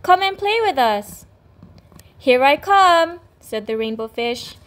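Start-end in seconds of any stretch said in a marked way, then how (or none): 0.0-1.3 high, bright fish voices calling. Acted. 2.2-3.2 high, loud, slow happy call; joyful call. Acted. Joyful reply to friends.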